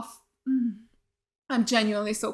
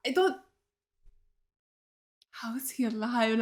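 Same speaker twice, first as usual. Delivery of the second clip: normal talking voice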